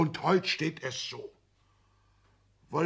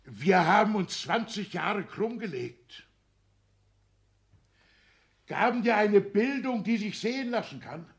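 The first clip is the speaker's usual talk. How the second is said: reproachful outburst; 8 semitones higher, 4 dB louder than usual. Acted. Father reproaching his son for their sacrifices, loud. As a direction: pile on the guilt, rising.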